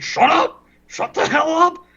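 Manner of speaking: high-pitched, squawking imitation of an outburst; comically strained high yell; mock angry yell. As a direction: imitate a furious little guy telling someone off. Retold angry outburst at Ruggs.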